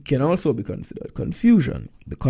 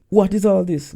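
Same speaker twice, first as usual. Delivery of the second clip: higher, louder startled question; surprised; 7 semitones higher, 6 dB louder than usual. Acted. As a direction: ask it in sudden disbelief. Tolroy confused at whole family.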